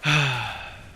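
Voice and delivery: long audible exhale, imitating the examiner; put-on examiner's sigh; imitated sigh. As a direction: let out a heavy sigh. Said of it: "she goes like this".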